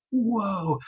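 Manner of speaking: taken aback, breathless; stunned at what the client told her; stunned whoa. Retold or imitated. Retold reaction to client's confession.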